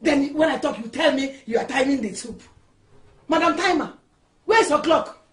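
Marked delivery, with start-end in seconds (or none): 3.3-5.2 loud, higher sarcastic jab; mocking scolding. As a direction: taunt her with the title. Mother-in-law mocks wife's excuse.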